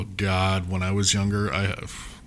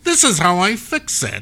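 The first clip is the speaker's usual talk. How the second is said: high, loud hammy doctor voice; 9 semitones higher, 5 dB louder than usual. Retold or imitated. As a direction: play the smug old-time doctor. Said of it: "That's my doctor voice from the nineteen twenties".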